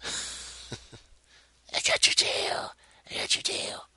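hushed whisper. Real, not acted. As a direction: whisper it close to the mic. Whispered repeated line.

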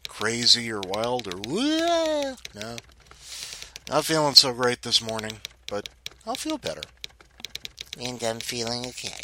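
ordinary delivery

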